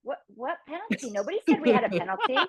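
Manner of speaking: mock indignant, rising high on the last question; replaying her confused protest to the producer; baffled outrage. Mother retelling her confusion, mock indignant and high. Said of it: "And I was like".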